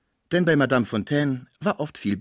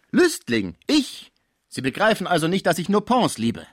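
indignant protest; 8 semitones higher, 6 dB louder than usual. Acted. Schmucke protests the accusation.

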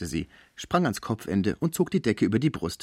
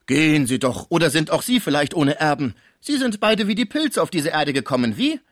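much higher, loud, mocking disbelief; incredulous concierge; 6 semitones higher, 6 dB louder than usual. A narrator's reading. Concierge mocking the sick man's chastity, high and loud. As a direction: scoff loudly at his claim. Cibot mocks.

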